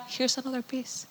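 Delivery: soft, secret offer of extra food; hushed aside. Retold or imitated. Kind cook slipping a child extra pizza, hushed. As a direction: slip it to her quietly, like a kind cook.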